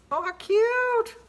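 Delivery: high, slow, cooing delight. Real, not acted. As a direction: coo over it. Opening a card.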